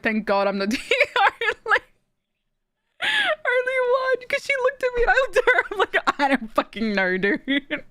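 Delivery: words breaking into high, squeaky laughter; real helpless laughter through the words; cracking up. Real, not acted. Streamer retelling a look shared with a friend, cracking up.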